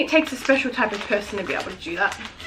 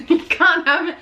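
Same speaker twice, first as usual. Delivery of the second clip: real giggle breaking into speech; laughing; 6 semitones higher than usual. Real, not acted. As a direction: laugh through the words. Laughing at own forbidden craving.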